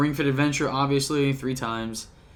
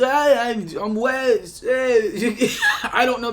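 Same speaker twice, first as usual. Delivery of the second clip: slurred tipsy rambling; loose, laughing drunk slur; 7 semitones higher, 3 dB louder, slower than usual. Pretend. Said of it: "that's my drunk voice".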